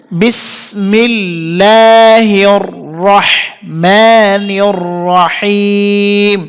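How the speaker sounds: slow recitation phrase by phrase with pauses; broken into parts. Shown as a demonstration. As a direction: recite it piece by piece, slowly. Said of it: "ভেঙে ভেঙে একবার বলে দিতেছি". (ভেঙে ভেঙে ধীরে তিলাওয়াত)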